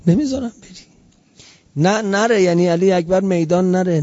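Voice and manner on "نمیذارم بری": the plea cracks and falls away; choked plea. Retold or imitated. Sister begs her brother again, her voice breaking. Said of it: "گفت".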